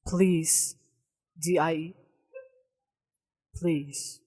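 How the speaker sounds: low, soft machine voice; Google Gemini voice. Acted. Co-host finishing the AI's message, in a low, soft Gemini voice. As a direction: spell it out coldly, letter by letter. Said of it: "Maghimo ka Google Gemini voice".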